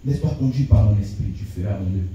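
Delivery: low, grave voice of the Almighty; big voice of God. Retold or imitated. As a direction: speak as God, low and solemn. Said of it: "Avec une grosse voix".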